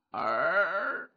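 soft, drawn-out rising creak; imitated door creak. A narrator's reading. Narrator imitates two doors opening, drawn-out creak. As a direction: mimic a door slowly swinging open.

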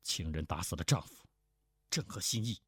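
low, restrained bitterness; bitter resentment. A narrator's reading. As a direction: say it bitterly, under control. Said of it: "胡斐恨恨的道". (压低声音，恨恨地说)

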